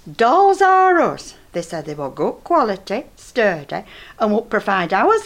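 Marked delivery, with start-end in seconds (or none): 0.1-1.3 slowly and much higher, proud of the find; innocent announcement. Retold or imitated. Old woman naively announcing the adult website she ordered from. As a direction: announce the shop name as if it is perfectly respectable.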